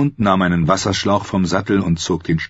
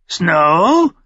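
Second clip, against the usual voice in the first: high, loud shrieking bird call; shrill raven croak of a name; screeching raven; 11 semitones higher, 4 dB louder than usual. A narrator's reading. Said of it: "kreischte er ihn an".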